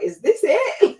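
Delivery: high, loud incredulous question; cocky surprise, pitch jumping up; mock disbelief. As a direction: act amazed it's over already. Retells breezing through a workout.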